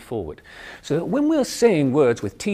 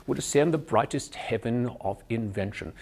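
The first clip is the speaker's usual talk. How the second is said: crisp British RP recital, lips alert; measured RP Shakespeare line; upper class British accent. Shown as a demonstration. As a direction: keep the upright RP mouth shape on "would ascend". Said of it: "I keep that shape".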